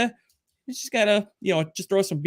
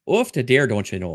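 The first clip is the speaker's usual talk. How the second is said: light Minnesotan "off the dare, don't you know"; Minnesotan accent; 2 semitones lower than usual. Shown as a demonstration. Streamer showing off his Minnesotan accent for the chat. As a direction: lay on the Minnesota folksy accent. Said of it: "how to do a proper Minnesotan accent".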